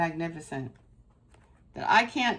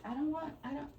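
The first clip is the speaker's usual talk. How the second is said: voicing her pet; 7 semitones higher, 12 dB quieter, faster than usual. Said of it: "She's like".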